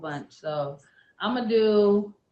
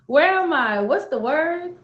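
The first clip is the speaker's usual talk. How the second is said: calling out; 7 semitones higher, 7 dB louder, slower than usual. Acted. Poem, calling out again.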